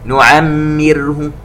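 نعمره slow, mim fully kasra so the ra is light; full kasra on mim. Shown as a demonstration. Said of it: "ونكسر الميم ... ترقق الراء". (إشباع كسرة الميم وترقيق الراء في نعمره)

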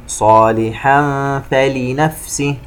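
slow chant, hummed tanween in "صالحا" before f; ikhfa recitation. Shown as a demonstration. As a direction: hum from "صالحا" into "فلنفسه". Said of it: "حرف الفاء إخفاء". (ترتيل بإخفاء تنوين "صالحا")

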